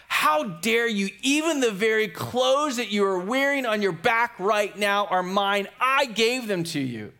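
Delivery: high, indignant outburst; acted exasperated outrage, pitched well up. Dad to Francis before the bishop, exasperated outburst. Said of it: "moment of exasperation".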